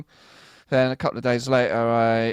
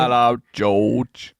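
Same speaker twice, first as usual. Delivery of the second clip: put-on drawn-out, louder London-accent greeting; 4 dB louder, much slower than usual. Greeting George on the podcast, in a put-on London accent. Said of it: "that's a good accent".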